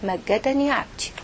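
slow, softened divine reply; in the ear. Acted. God answers the praying woman in her ear, quiet and slow. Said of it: "في ودنك".

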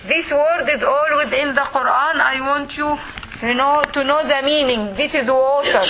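normal talking voice

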